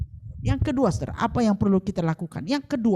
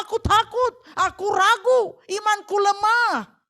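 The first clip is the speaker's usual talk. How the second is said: crying out; 9 semitones higher, 4 dB louder than usual. Shown as a demonstration. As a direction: shout your weakness to God. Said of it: "Kita mengakui kelemahan kita".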